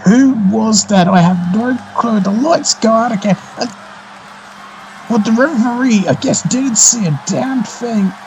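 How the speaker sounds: high-pitched live play-by-play; wrestling commentator. Real, not acted. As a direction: call the action as it unfolds. Live commentary of a chaotic finish.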